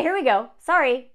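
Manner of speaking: ordinary talk, nothing marked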